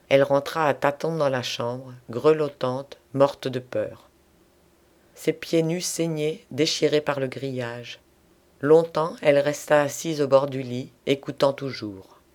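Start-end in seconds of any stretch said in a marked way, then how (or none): none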